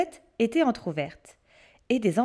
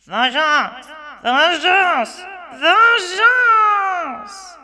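from loud to a high screaming cry; witch's vengeful roar, higher and louder each time; furious shout building; 7 semitones higher, 8 dB louder, much slower than usual. Acted. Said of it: "elle s'écria".

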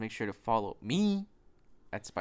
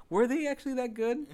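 doubting listener; 8 semitones higher than usual. Retold or imitated.

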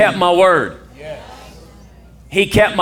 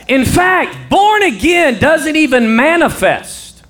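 full-voiced, high-pitched preaching; 9 semitones higher, 5 dB louder than usual. Real, not acted. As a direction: drive each phrase home to the congregation.

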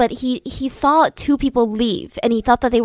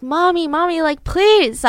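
mild mock scream; 6 semitones higher, 3 dB louder than usual. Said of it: "he's screaming at his mom".

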